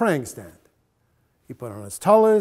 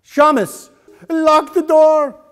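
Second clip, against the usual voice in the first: loud, high, slow command; commanding order; 12 semitones higher, 10 dB louder, slower than usual. A narrator's reading. Rabbi orders the shamus to lock door, loud command. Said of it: "ordered our rabbi".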